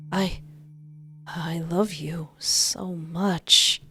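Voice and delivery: slow, soft I love you; soft and sweet. Acted. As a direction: say it slowly and tenderly. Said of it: "genuinely soft tone".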